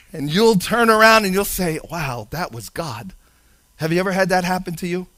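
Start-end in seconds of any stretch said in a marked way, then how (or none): 1.9-3.1 soft, low, awed realization; hushed awe. Pretend. Believer realizing God was at work, hushed and awed. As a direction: breathe it out in wonder.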